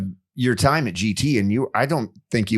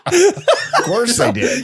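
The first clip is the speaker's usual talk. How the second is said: high, slow words through laughter; laughing disbelief; 4 dB louder, much slower than usual. Real, not acted.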